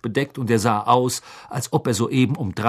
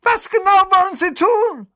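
shouting; 6 dB louder than usual. A narrator's reading. Vernon bellowing at chimney.

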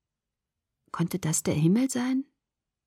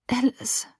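weak, higher-pitched moan; groaning; 5 semitones higher, 4 dB quieter than usual. A narrator's reading. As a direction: moan the name in pain. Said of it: "stöhnte ich". (schwach gestöhnt, voller Schmerz)

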